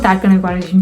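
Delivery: usual speaking voice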